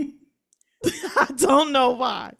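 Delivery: drawn out, slow and laughing. Real, not acted. Asked why she cried, she laughs slowly. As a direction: let the laugh stretch the words out. Laughing at her own reaction.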